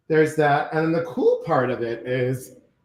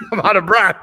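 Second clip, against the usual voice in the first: louder, laughing through the breathlessness; laughing out of breath; 5 semitones higher, 5 dB louder, faster than usual. Real, not acted. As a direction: laugh it off while still winded. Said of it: "I just ran up these stairs".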